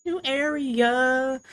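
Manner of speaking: high, drawn-out announcement; excited. Acted. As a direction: stretch it out gleefully. Excited repeat, higher and slower.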